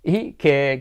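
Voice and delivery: ordinary talk, nothing marked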